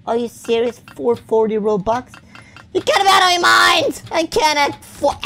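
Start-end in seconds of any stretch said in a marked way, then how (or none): 2.7-5.2 loud, high outburst at the price; high-pitched, loud protest; outraged yell. Real, not acted. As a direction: yell it in indignant disbelief.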